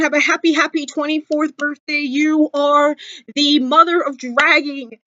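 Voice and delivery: normal talking voice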